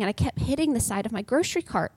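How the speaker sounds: ordinary delivery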